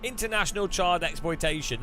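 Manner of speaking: usual speaking voice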